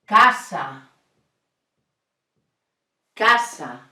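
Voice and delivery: casa with two open short a sounds; Spanish casa. Shown as a demonstration. Teacher modelling a Spanish word for students. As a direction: say casa cleanly, pure vowels. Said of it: "A word in Spanish with A".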